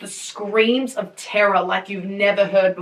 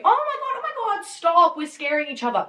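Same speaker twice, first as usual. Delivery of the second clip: a touch of mock panic, much higher; imagined alarmed thought; 7 semitones higher than usual. Voicing the listener's guessed reaction, mock high panic. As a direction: pretend frantic "stop". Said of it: "first thought of yours might be".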